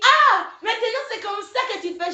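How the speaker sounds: high-pitched mock indignation, loud opening "Ah"; reproche outré imité. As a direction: mimic his affronted complaint, voice jumping up. Imite le reproche du garçon snobé. (imitation aiguë d'un garçon vexé)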